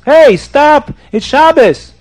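high, loud cry to stop; alarmed shout. Acted. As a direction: shout in protest at the dog. Yelling at the candle-eating dog.

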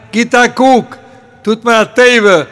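loud, high, pressing plea; insistent urging. Acted. Man urging a stranger to take a look, insistent plea. Man urges another to check wallet.

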